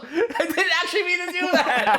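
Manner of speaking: high laughing protest. Real, not acted. Laughing at own mistake.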